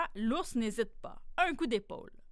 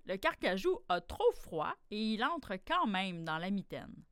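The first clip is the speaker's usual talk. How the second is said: ordinary delivery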